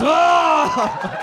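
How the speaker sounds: high, loud comic wail; frantic open-throated yell; hammed-up scream. Short man straining to please his tall lover, screaming. Acted frantic bedroom scene.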